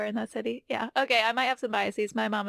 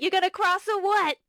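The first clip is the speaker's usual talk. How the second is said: loud, high New York tough-guy voice; New York accent; 9 semitones higher, 6 dB louder than usual. Retold or imitated. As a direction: bark it like a gruff New Yorker. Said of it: "talking in their normal New York accent".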